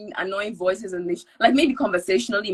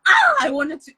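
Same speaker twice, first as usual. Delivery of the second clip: imitating a high-pitched announcer; 8 semitones higher, 7 dB louder than usual. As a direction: lift into a thin high voice. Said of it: "She had this high-pitched".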